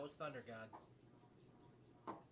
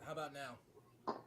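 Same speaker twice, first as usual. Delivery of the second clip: distant, faint, off-mic voice; far from mic; 3 semitones higher, 16 dB quieter than usual. Real, not acted. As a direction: speak from across the room. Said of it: "Still sound pretty far away".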